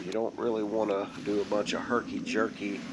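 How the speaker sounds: usual speaking voice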